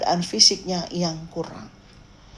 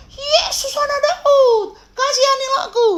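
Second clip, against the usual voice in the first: desperate shouting; 3 dB louder than usual. Acted. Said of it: "dia berteriak". (berteriak memohon, melengking tinggi)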